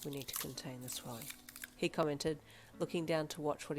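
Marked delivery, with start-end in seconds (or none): 0.0-1.3 put-on low, soft, calm young man; steady quiet reassurance; calm low male voice. A narrator's reading.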